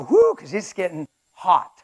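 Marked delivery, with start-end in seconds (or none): 0.0-0.3 high, loud "whoo"; sudden high-pitched whoop; mock whoop. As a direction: yelp as if the heat just hit you. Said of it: "Now he has to go".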